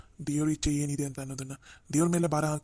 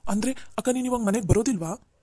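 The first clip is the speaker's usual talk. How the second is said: surprised; 5 semitones higher, 4 dB louder than usual. Acted. Sister shocked she won't come home.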